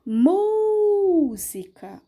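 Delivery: "Música" with a high, very long MÚ; stressed first syllable. Shown as a demonstration. Teacher shows pupils the tonic syllable, long high MÚ in "Música". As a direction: sing out and hold the MÚ of "Música". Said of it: "eu abro minha boca".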